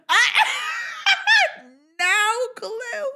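very high, drawn-out laughing wail; real laughter breaking up the words; laughing admission. Real, not acted. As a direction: confess it through helpless laughter, stretched out. Laughing at own ignorance.

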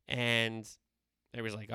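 ordinary talk

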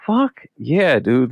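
high, delighted outburst; thrilled. Retold or imitated. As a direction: cheer like you just got VIP. Thrilled at VIP backstage access.

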